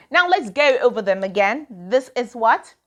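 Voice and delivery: normal talking voice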